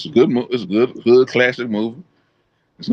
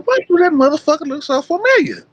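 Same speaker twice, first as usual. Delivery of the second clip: much higher, baffled mock question; mock shocked high voice. Retelling spotting Ken in the movie, mock disbelief. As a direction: act out the shock of recognising him. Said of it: "I said".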